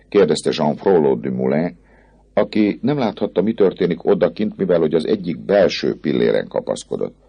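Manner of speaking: ordinary delivery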